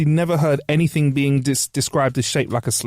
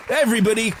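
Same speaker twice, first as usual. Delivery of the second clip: ordinary talk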